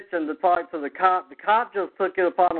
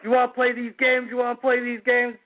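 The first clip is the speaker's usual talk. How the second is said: imitating a confrontational cop, pitched up; retold cop's challenge; 6 semitones higher than usual. Cop confronts the fireworks shooter, mock challenge. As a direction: challenge him like an irritated officer, retold.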